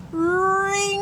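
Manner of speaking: high ringing "ring"; bell ring sound. A narrator's reading. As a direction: sing it like a school bell. Bell rings in story.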